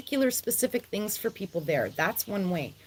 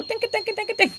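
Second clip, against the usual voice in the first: high playful ding-ding, much higher; cowbell imitation; 11 semitones higher, faster than usual. Imitating her favourite cowbell.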